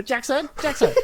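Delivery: high-pitched calling of a name; imitating friend's mum. Mum walking over to her son, high insistent calling. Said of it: "Your mum walks over".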